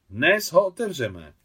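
loud, drawn-out declaration. A narrator's reading. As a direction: announce it so the newcomer hears. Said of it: "řekla nahlas Maria".

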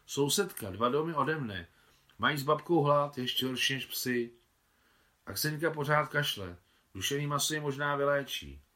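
put-on a boy earnestly pleading for a sick girl; a hint of a boy speaking up for his neighbour.

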